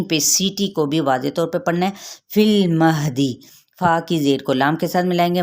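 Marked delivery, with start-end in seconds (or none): 2.3-3.4 slow tajweed फिल महदी; recitation. Shown as a demonstration. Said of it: "हा ... अक्सा हलक से अदा करेंगे".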